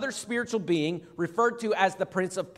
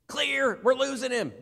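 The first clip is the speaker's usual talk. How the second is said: higher-pitched urgent resuscitation call; mock emergency medic; 5 semitones higher than usual. Preacher playing a medic reviving a dying patient, mock urgent. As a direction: call it out like a medic with defibrillator paddles. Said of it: "being revived with a defibrillator".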